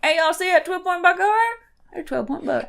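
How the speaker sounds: quick, casual Kentucky twang; local Kentucky accent. Shown as a demonstration. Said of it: "We don't sound like, it'd be".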